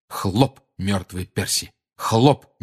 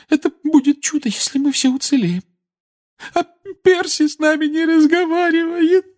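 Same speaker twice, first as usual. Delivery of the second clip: high, loud weeping worry; Molly weeping about her family to Harry; tearful fear; 4 dB louder than usual. A narrator's reading. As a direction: pour out fears through tears.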